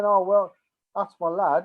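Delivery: loud hammed-up praise of a son; mock proud dad. Men praising a boy who got angry, loud mock pride. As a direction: boom it out like a proud father in the pub. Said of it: "they wouldn't be saying".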